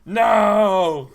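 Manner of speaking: huge high anguished roar; monster howl. Acted. Nemesis hearing of the sacrifice, anguished scream. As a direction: let out a full-throated howl.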